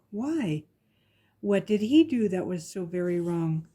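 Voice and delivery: ordinary delivery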